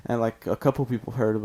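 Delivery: ordinary talk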